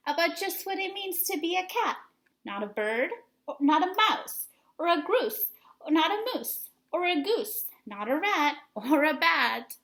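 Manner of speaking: a hint of a nagging mother; lecturing mother. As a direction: rattle off the lecture like a nagging mom. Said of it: "The lecture went on".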